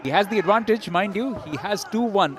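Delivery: live ringside call, voice up; excited call. Real, not acted. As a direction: raise your voice as the count lands. Standing count, commentator excited.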